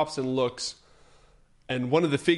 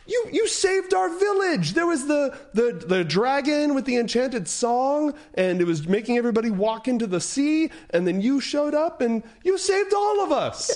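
much higher, breathless with gratitude; gushing villager; 12 semitones higher than usual. Acted. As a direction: gush thanks at the hero. Grateful villager gushing to hero.

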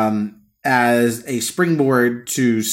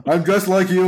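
high put-on pleading voice; Bernie Sanders; 7 semitones higher than usual. Senator caged in accent zoo, mock pleading impression. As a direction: appeal to the onlookers in a hammy accent. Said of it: "Put people like Bernie Sanders in the accent zoo".

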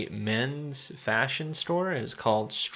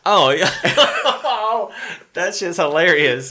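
loud laugh breaking through, higher; real laughter, louder; 7 semitones higher, 8 dB louder than usual. Real, not acted. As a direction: crack up while agreeing. Laughing at a movie scene.

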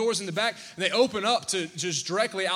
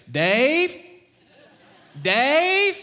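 loud calling of a name across the water; 4 semitones higher, 7 dB louder than usual. Retold or imitated. Friend in cold ocean calling Dave over, loud call. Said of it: "And I hear him say".

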